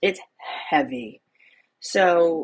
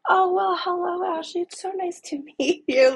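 sweet and friendly; 12 semitones higher than usual. Retold or imitated. Kind man greets child caller.

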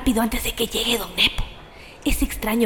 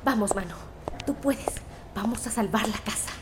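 en voz baja; 8 dB quieter than usual. Acted. Se da ánimos a sí misma.

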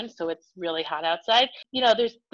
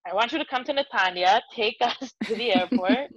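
higher-pitched imitation of a Spanish-accented dad; 5 semitones higher than usual. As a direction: repeat the request in dad's Spanish accent. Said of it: "so he has a great accent".

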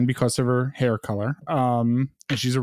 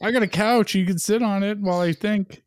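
playful Sabine voice; 7 semitones higher than usual. Acted.

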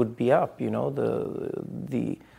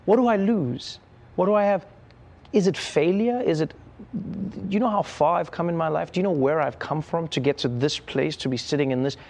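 inner pep talk; 6 semitones higher than usual. Retold or imitated. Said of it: "I went".

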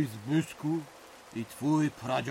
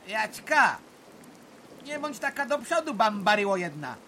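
teasing scold; 7 semitones higher, 4 dB louder than usual. Acted. Uncle scolds cheeky niece.

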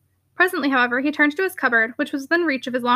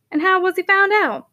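put-on sharp, higher questioning old clergyman; brisk rector voice; 5 semitones higher, 3 dB louder than usual. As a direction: demand it like a keen rector. Hellstone voiced, timbre shifted.

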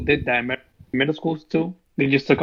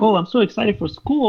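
bright mock kid excitement, higher; fake cheerful actor; 7 semitones higher than usual. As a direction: imitate an overeager ad kid. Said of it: "a actor ... talking about".

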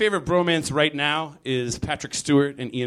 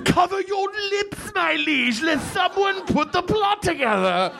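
very high, hammed-up British proclaiming; mock British courtier. Adviser to his liege, very high mock British. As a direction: cry it like an overwrought royal adviser. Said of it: "courtier continues".

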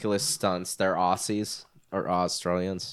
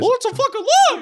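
cartoon voice; 8 dB louder than usual. Mocking cartoon voice.

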